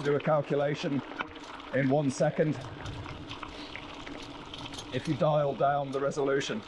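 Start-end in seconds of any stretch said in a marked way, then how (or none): none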